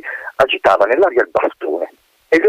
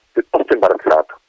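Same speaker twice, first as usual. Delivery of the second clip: plain reading voice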